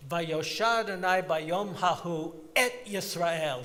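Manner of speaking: imitating Henry, lean on the little word "et"; stress on et. Rabbi imitating Henry's prayer leading, slow with "et" stressed. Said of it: "He would emphasize the word et".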